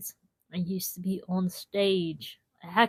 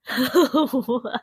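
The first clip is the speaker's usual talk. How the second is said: laugh; 4 semitones higher, 6 dB louder than usual. Real, not acted. Laughs at own joke.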